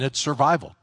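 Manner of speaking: ordinary delivery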